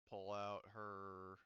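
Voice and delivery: normal talking voice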